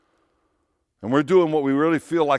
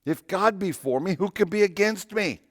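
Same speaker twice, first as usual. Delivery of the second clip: pitched much higher, proclaiming; raised in triumph; 8 semitones higher than usual. Real, not acted. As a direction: lift it up like a victory cry. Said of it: "Romans, right?".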